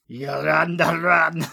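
loud mock Scottish with a rolled run; Scottish accent. Host mimics Daisy's line in a Scottish accent. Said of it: "not a bad attempt".

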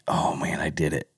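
hushed whisper of guilty realization; whispered oh man I did it. Retold or imitated. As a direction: whisper it like you just caught yourself.

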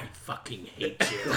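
low, hushed mock loathing; imitating a deadpan hateful murmur; retold hateful reply. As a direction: say it softly, almost whispered. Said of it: "And she's like".

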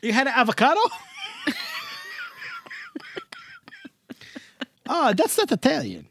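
put-on thick Jersey Italian accent; Italian-American accent. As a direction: play a warm old Italian uncle. Held Italian-American character voice.

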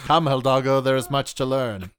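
grand, solemn mentor speaking to his horse; wise mentor voice. Pretend. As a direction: speak like a wise old guide. Grand mentor addressing horse Hidalgo.

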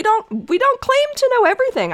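high and fast, insisting hard; emphatic. Real, not acted. Emphatic high rush before sarcasm.

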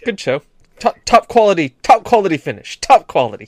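hammed-up English good sport; exaggerated politeness. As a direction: congratulate a rival far too courteously. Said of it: "has to be polite to each other".

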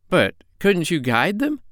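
high, slow scolding monkey. Acted. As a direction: chide like a monkey. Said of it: "asked Chee-Chee".

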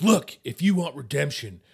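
impassioned plea rising to a high, loud shout; hammed-up dramatic outburst; committed overacting, building. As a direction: build to a full-out movie-hero speech. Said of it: "in his still committedness".

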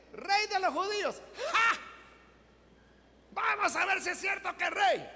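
high, loud mock acclamation; jeering. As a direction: taunt like a jeering crowd, with a scornful ha. Soldiers mocking Jesus as king.

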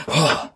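sharp high gasp of relief. Real, not acted. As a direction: gasp as the strain lets go. Relief cry after stopping.

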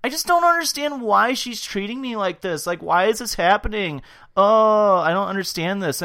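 high, loud mock whining; Nicole's confessional whine. Host imitating Nicole in a confessional, mock whining. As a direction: wail it like a put-upon victim, hammed up. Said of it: "Nicole in a fucking confessional being like".

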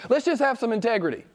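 high, quick appeal without added volume; earnest appeal. Real, not acted. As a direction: plead briskly for honesty, pitched high. Appeal, first of two takes.

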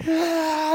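high-pitched imitated bawl; hammed-up crying 'ah'; mock child wail. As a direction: wail like a sobbing little kid, acted. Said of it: "he's just crying and crying".

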